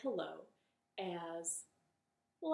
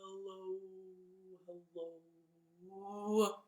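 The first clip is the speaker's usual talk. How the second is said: very slow hellos; 2 semitones lower, faster than usual. Shown as a demonstration. Storyteller leading kids in the slowest hellos. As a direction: draw each hello out slowly. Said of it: "as slow as we can".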